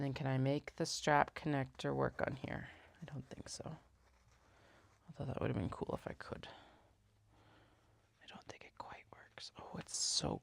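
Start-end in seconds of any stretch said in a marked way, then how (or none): 3.0-10.4 whispered to herself under the breath; whispering. Real, not acted. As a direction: whisper it like thinking aloud. Musing over a layout.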